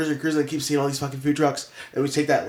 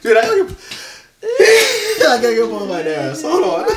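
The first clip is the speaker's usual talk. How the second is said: much higher, laughing through words; breaking up with laughter; laughing hard; 11 semitones higher, 7 dB louder than usual. Real, not acted. Laughing fit, needs nose blown.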